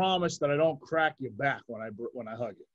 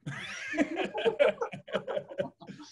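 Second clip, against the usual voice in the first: a breathy, high-pitched giggle; high airy giggle. Real, not acted. Cracking up at his own joke with a high giggle. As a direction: let a squeaky laugh slip out. Laughs after mock whisper.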